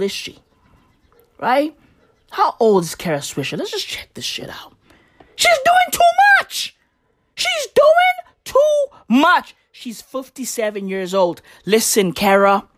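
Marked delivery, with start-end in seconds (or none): 3.6-4.7 quick unvoiced whisper aside. Real, not acted. Aside while googling. 5.4-9.6 high, strained exasperated yell; exasperated shout. Real, not acted. Exasperated rant at Kara.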